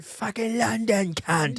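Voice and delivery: slow, high mock London yob accent; mock English yob. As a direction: growl it out like a sore English fan. Imitating angry English kid.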